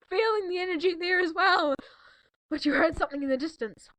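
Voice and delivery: ordinary delivery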